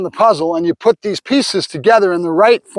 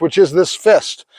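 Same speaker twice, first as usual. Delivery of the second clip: ordinary delivery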